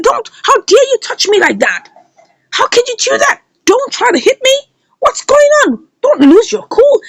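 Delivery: high-pitched acted outrage; retold indignant protest. Flora to Madam Uchechi who tries to beat her, acted indignant protest. Acted quarrel after attempted beating.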